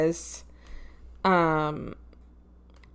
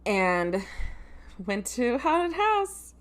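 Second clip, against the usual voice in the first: normal talking voice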